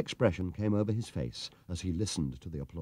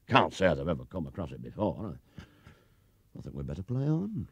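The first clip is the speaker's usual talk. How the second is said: lower, ponderous countryman voice; 3 semitones lower, 5 dB quieter than usual. A narrator's reading. Umpire continues.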